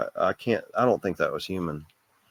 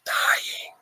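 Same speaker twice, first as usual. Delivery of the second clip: breathy unvoiced whisper of a word; ghostly whisper. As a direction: whisper it like a faint spirit voice. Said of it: "it goes".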